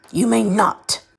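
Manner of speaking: sharp, loud snap; snapping. Acted. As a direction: bark the refusal. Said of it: "Voldemort snapped".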